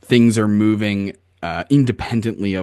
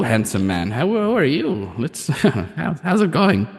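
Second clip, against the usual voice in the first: high crone's voice, flirting; 6 semitones higher than usual. Acted. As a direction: coo at him like an old crone.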